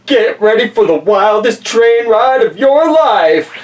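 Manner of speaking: loud, high, hyped-up trailer voice; back cover blurb. Acted. Reading the back cover to viewers, hyped trailer voice. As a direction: sell it like a movie trailer. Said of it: "on the back it says".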